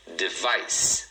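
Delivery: "device" as de-VICE; "device" in two syllables, ending on a clear S; stress on second syllable. Shown as a demonstration. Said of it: "a segunda é a mais forte".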